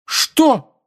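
very high, indignant squeal; shrieking. A narrator's reading. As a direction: squeal it in disbelief. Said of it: "взвизгнул Джеймс".

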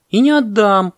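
high, loud monkey cry; defiant shout. A narrator's reading. Monkey shouts from the palm, defiant. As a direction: call it out stubbornly. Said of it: "крикнула с пальмы мартышка".

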